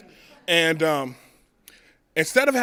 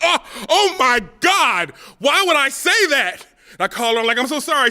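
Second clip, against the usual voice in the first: panicked remorse; 11 semitones higher, 9 dB louder than usual. Retold or imitated. Comic realizing what he said to his girlfriend, panicked apology. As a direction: plead in sudden panic.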